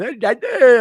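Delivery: high, goofy put-on character wail; silly imitation of a burnt-out oddball; burnt-out alter ego. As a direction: call out as the drugged-out, burnt-out guy. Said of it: "Slow Drug Emo".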